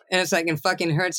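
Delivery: ordinary delivery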